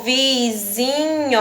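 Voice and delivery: "vizinho" drawn out very slowly, leaning on the "zi"; stressed syllable demo. Shown as a demonstration. Teacher showing pupils the stress in "vizinho", slow and deliberate. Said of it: "não tá na penúltima".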